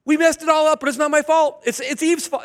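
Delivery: high, loud whiny blame-shifting; mock childish Adam blaming Eve; whiny Adam excuse. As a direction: play Adam pointing at Eve. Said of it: "What's Adam say?".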